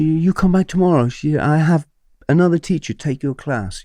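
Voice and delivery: put-on high-pitched Hong Kong accent; Miss Lynn. Head of English excusing late teacher, Hong Kong accent. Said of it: "If I do the accent".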